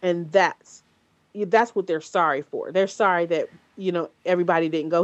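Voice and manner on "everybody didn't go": normal talking voice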